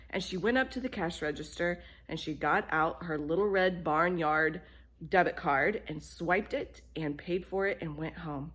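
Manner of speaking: plain reading voice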